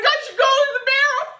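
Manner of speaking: high, loud mock shout through laughter; panicked shout. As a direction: yell it as you flee, laughing. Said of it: "being like".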